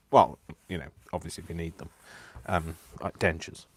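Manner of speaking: ordinary delivery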